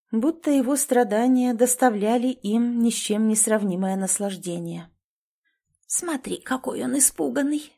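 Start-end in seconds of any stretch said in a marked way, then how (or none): 5.9-7.7 high, soft leering crone whisper; old woman whispering. Acted. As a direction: gloat in a hushed crone whisper. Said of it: "прошептала другая старуха".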